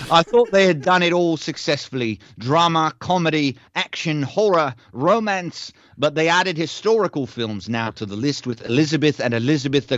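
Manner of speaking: bright, lightly heightened reading voice; review read aloud. Acted. Host reads a listener's review aloud in a bright voice. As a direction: read it like an upbeat testimonial.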